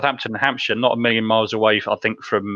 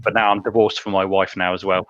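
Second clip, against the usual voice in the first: ordinary talk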